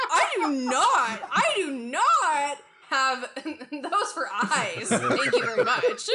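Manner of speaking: indignant words broken by laughter; laughing offended. Acted. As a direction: protest through giggles, slowly.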